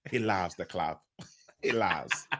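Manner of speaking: lightly put-on Italian accent, low; playful fake Italian accent, laughing through it; mock Italian accent. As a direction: say it like a hammed-up Italian. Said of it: "my Italian accent".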